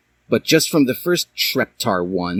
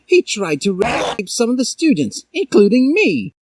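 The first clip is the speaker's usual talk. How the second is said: higher, rising distress; upset student; 13 semitones higher than usual. A narrator's reading. Student reporting the teacher, higher and upset.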